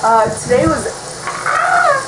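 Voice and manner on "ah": short, very high "ah"; sudden cry. Real, not acted. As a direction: break off with a high-pitched yelp. Sudden cry breaking off his sentence.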